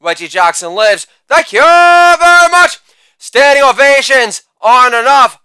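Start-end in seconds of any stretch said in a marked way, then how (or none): 1.3-2.8 hammed-up stage thank-you; showman sign-off. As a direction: stretch out the thanks like a showman taking a bow.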